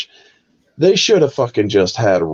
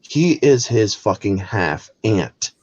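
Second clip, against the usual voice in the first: normal talking voice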